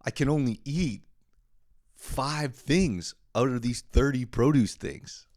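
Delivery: high, slow, put-on helpless voice; mock overwhelmed shopper. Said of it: "and you're like".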